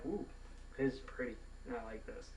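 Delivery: hushed, approving murmur; soft little ooh of approval; softly admiring. Retold or imitated. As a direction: admire it quietly to yourself. Acts admiring new game.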